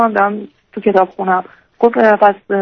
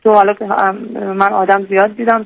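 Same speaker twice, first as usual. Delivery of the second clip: normal talking voice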